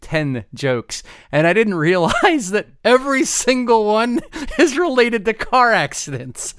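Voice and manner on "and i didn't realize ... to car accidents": talking through laughter; laughing. Real, not acted. Co-host admits every joke is about car accidents, laughing. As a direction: giggle out the confession.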